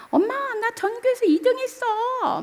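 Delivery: high, bright-voiced teen girl announcing news; excited daughter. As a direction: squeal the good news like a thrilled daughter. Daughter's phone call voiced. (엄마에게 전화로 기쁜 소식을 전하는 딸, 높은 목소리)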